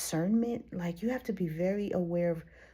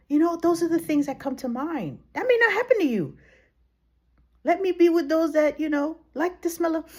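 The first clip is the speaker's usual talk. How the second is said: much higher and louder, faster; excited; 8 semitones higher, 7 dB louder than usual. Real, not acted. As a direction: gush with delight. Enthusiastic rising outburst.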